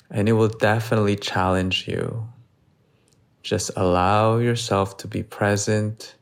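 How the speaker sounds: steady yoga instructor, long pauses between phrases; guided meditation. Real, not acted. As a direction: guide them slowly and evenly through each breath. Leads breath hold and meditation.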